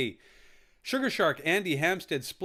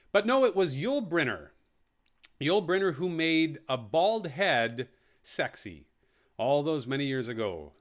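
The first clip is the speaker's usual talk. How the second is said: normal talking voice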